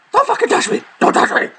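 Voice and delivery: high shrill mock outburst; shrieking protest. Tickled girlfriend shrieks at him not to touch her. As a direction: shriek it out of breath.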